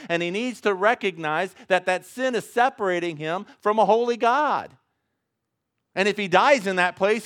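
rising, loud pulpit preaching. Acted. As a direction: preach it out to the congregation. Preacher at full force.

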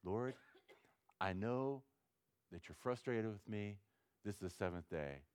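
soft, hesitant plea on the seventh day; leise, zögernde Bitte; quiet prayer. Shown as a demonstration. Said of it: "I go before God".

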